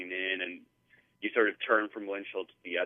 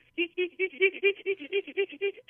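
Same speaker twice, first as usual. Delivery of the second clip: high squeaky scared giggle; squeaky laugh. Retold or imitated. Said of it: "She just gets this squeaky laugh".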